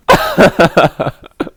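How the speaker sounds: loud real laugh; big real cackle; loud burst of laughter. Real, not acted. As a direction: laugh out hard at the absurdity. Big laugh after sir.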